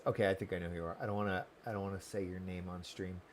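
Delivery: dropped almost to a murmur; quiet aside. Real, not acted. Streamer discreetly avoiding a viewer's name, quiet. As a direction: keep it low and private.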